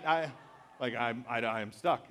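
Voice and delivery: ordinary talk, nothing marked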